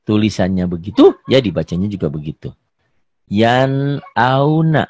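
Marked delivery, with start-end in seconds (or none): 3.3-4.9 yan auna stretched out very slowly; slow recitation. Shown as a demonstration. Teacher reciting yan auna slowly to students. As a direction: draw out yan auna for the class. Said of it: "Hukumnya apa yan auna".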